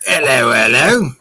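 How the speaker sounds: put-on British accent greeting; hammed-up British hello; broad mock-British voice; Captain Chokeout. Shown as a demonstration. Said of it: "there was a Captain Chokeout accent".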